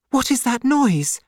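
startled by a noise; frightened cry. Acted. Lucy hears a noise at the door, alarmed cry. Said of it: "Lucy suddenly cried".

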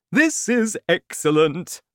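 high, loud, pleased exclamation; delighted father. A narrator's reading. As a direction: relish your success.

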